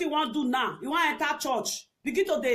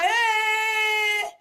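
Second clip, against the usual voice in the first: sharp mock shout of "Hey!"; 5 semitones higher, 3 dB louder than usual. Telling the crowd to shut up, with a mock shout. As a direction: bark "Hey!" at the noisy crowd. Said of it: "I go dey shout".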